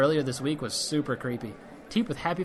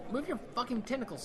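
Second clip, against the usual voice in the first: muttered curse; 9 semitones higher, 9 dB quieter than usual. Acted. Pushing through the crowd, a quiet muttered curse. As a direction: grumble it quietly while squeezing past.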